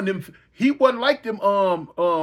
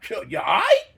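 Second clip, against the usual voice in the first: startled high voice. Retold or imitated. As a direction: voice shoots up high, alarmed. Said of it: "I'm like".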